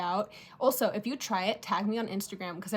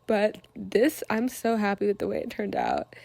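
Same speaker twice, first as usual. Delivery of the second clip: ordinary talk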